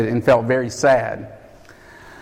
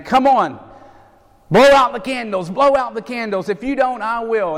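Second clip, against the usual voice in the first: pushy cook; 8 semitones higher than usual. Retold or imitated. Pushy cook Harry urging.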